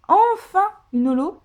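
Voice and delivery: high, loud, emphatic delight; elated, leaning hard on ENFIN; elated relief. Real, not acted. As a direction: let out long-awaited joy. Relief at long-awaited holo.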